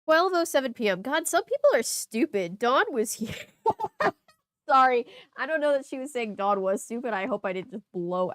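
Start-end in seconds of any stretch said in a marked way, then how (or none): 3.6-4.1 high, sudden laugh; burst of laughter. Real, not acted. Reader laughing at the diary's insult. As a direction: break out laughing.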